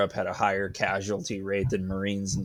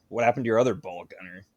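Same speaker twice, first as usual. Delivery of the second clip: ordinary talk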